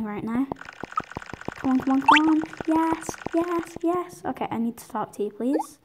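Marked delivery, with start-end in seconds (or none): 1.6-4.2 eager cheering. Real, not acted. Waiting for game, then success.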